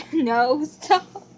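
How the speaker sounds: laughing, squealing no, stop; giggling while being tickled. Real, not acted. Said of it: "You're tickling me".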